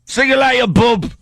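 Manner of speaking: put-on high English-accented holler; mock English send-off. Co-host seeing the intern off in a high mock-English shout. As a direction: cry it out like a cartoon Englishman, accent thick.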